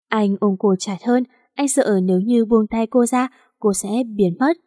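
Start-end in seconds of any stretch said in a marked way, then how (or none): none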